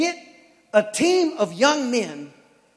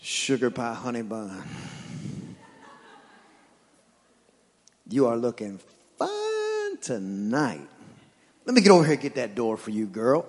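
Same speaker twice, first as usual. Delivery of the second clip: low, smooth sweet-talking husband; lightly voiced smooth-talking husband; smooth charmer; 9 semitones lower than usual. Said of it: "how you used to date your wife".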